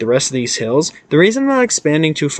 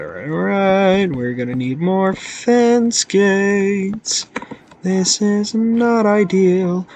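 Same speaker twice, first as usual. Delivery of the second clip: high, drawn-out mock complaint; playful high whine; 7 semitones higher, much slower than usual. Player needs more fence gates, playful high whine. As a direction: stretch it out slowly in a high put-on voice.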